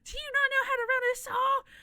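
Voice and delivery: high mocking yell of an angry supervisor; squeaky parody of a man screaming at you; high squawky mock scolding; mock yelling boss. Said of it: "he started screaming at me".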